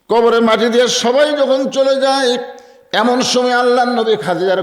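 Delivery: high, ringing sermon narration; impassioned preaching. Acted. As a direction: raise the voice into a preacher's chant for the graveside story. Preaches the graveside scene at full pitch. (উঁচু সুরে আবেগভরা ওয়াজ)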